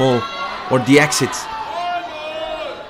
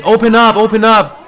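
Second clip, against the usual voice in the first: higher, louder call to open up; relayed crowd shout; 6 semitones higher, 3 dB louder than usual. Retold or imitated. Crowd demanding the guard open up, raised call.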